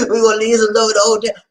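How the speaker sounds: laughing. Real, not acted. Retelling the goodbye to the hotel, laughing as it comes out. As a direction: say it through a big laugh. Repeats own line, laughing.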